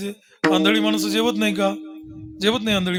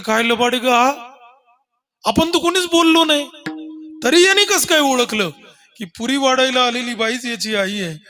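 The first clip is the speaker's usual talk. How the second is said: higher, emphatic amazed women; acted astonishment; 4 semitones higher, 3 dB louder than usual. Women marvel at how the boy knew, high astonished exclaiming. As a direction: play the women utterly taken aback. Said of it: "सर्व बायांनी तोंडात बोट घातलं".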